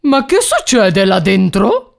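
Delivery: mildly voiced irate farmer, slow; angry farmer. A narrator's reading. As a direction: demand an answer as a cross farmer, without shouting. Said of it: "urlò all'improvviso il contadino arrabbiatissimo". (contadino arrabbiato, urlo trattenuto)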